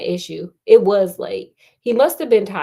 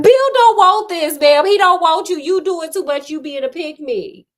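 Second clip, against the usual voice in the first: much higher, loud telling-off; exasperated yell; 7 semitones higher, 7 dB louder than usual. Real, not acted. Host yelling at the heroine that Bill doesn't want her. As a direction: shout her down, she's doing too much.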